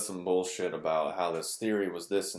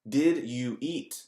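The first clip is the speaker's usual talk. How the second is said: higher, slow, over-enunciated; mock slow careful question; overly careful speech; 6 semitones higher, 5 dB louder, much slower than usual. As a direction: spell it out slowly, mockingly. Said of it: "who spews a sentence into a word".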